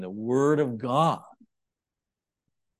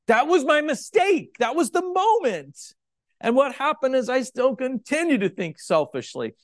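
much higher, louder emphatic declaration; emphatic preaching; 13 semitones higher, 8 dB louder than usual. Acted. Preacher confessing to the congregation, loud high emphasis. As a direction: hammer home the mistake. Preacher admits his mistake.

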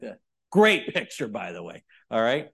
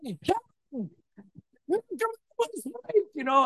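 cheering scream. Retold or imitated. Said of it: "I'm, like, screaming".

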